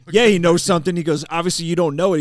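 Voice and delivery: mock gleeful jab. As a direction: rub it in loudly. Sam mocking the guy.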